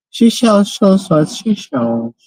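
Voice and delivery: playful high voice. Pretend. Celebratory retry in funny voice.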